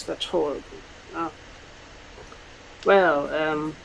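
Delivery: ordinary talk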